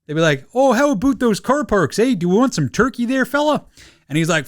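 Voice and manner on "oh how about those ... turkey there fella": higher, chirpy mock Canadian accent. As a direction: play up a friendly Canadian accent. Imitating Canadian family at Christmas.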